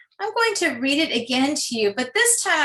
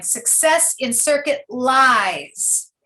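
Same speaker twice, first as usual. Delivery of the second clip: "Success in circuit lies" slow, rhyme word LIES drawn out; measured "Success in circuit lies", landing on LIES; stress on LIES; slower than usual. Shown as a demonstration. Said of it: "the word lies in the second line".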